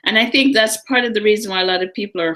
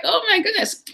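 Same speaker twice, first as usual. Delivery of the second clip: stunned realization; 13 semitones higher than usual. Retold or imitated. Realizing she can say no.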